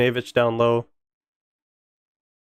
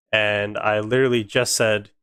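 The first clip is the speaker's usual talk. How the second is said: ordinary talk, nothing marked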